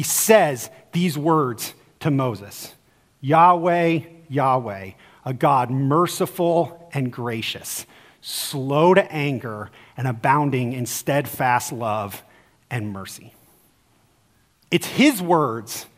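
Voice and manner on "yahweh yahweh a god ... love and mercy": slow, soft, lower reverent quote; solemn proclamation. Acted. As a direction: proclaim it gently and slowly. Quotes God's self-revelation.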